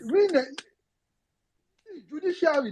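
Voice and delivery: ordinary talk, nothing marked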